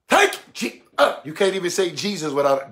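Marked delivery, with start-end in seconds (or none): 0.1-0.4 "Thank" jerked out high and sudden; jerked testimony. Shown as a demonstration. Preacher demonstrates emotionalism, "Thank" in a high sudden jerk. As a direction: jolt on the word "Thank". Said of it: "how you jerk".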